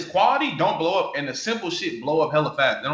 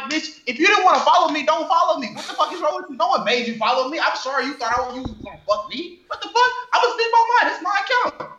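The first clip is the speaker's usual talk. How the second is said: mock angry retort; 9 semitones higher than usual. Telling off followers who complain, a high laughing mock rant. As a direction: blow up at them in a squeaky mock fury.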